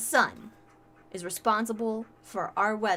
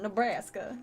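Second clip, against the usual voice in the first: Midwest accent. Shown as a demonstration. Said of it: "It's my Midwest accent".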